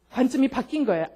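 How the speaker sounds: ordinary talk, nothing marked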